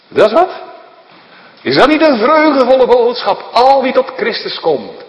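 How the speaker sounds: high, loud joyful proclamation; exultant preaching. Acted. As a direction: shout out the good news. Joyful climax of sermon.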